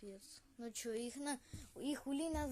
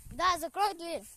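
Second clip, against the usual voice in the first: yelling across the room; 7 semitones higher, 8 dB louder than usual. Real, not acted. Yelling to shut the door.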